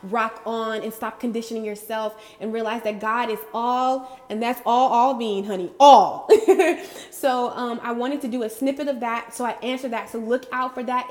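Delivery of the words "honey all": laughing. Real, not acted. Laughing peak of sermon.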